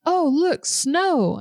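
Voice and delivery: put-on high, delighted little-kid voice; child's delight. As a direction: play the toddler thrilled at fake snow. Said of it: "And she said".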